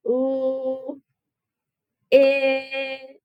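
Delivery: "Ooh, it" as stretched separate l, short i, t sounds; sounding out letters. Shown as a demonstration. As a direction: hold each sound on a level note. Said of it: "think about the sounds".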